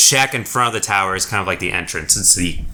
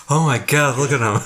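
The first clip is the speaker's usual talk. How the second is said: higher-pitched mock astonishment; amused, acted disbelief; onlookers' amazement; 6 semitones higher than usual. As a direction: react like gawking guards. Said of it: "kind of watching and kind of like".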